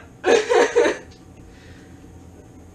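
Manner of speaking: big burst of laughter; loud laugh. Real, not acted. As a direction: laugh loudly at yourself. Laughs at own outburst.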